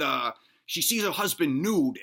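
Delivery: ordinary talk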